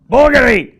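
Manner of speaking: loud, high burst of the name; excited shout. Real, not acted. Wine reviewer excitedly shouting out the bottle's region.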